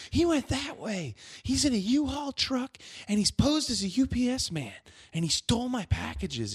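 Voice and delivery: retelling the robbed neighbour's frantic report, high and anxious; imitating a freaked-out lady. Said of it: "And she's freaking out".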